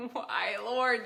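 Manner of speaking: ordinary talk, nothing marked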